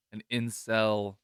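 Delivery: ordinary talk, nothing marked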